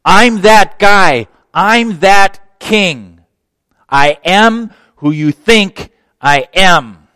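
high, loud, slow proclamation as king; slow ringing announcement of kingship; bold royal claim. Retold or imitated. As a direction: declare it like a king arriving. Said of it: "he's saying".

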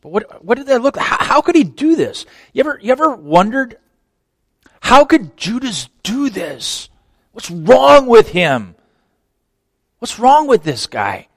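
high, loud, urgent questions from the pulpit; fervent preaching. Acted. Preacher pleading with the congregation, fervent and loud.

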